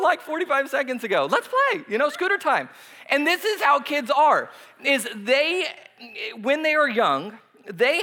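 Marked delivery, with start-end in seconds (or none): 1.3-2.7 put-on much higher, bright kid pitch; eager kid voice. Pastor imitating his son asking for scooter time in a much higher voice. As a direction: play the eager little boy.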